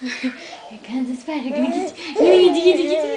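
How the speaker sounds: mock menace turning into gleeful loud teasing; tickle game build-up. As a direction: creep in then pounce with the tickle. Tickle game spider hand.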